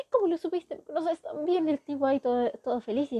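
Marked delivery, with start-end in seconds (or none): 0.0-0.1 sharp gasp up high; high surprised gasp. Retold or imitated. El chico se sorprende. 0.1-1.7 higher, delighted questions; thrilled she knows him; excited high voice. Retold or imitated. Chico emocionado responde.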